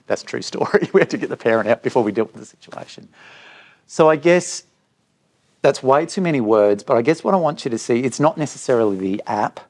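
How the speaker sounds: ordinary delivery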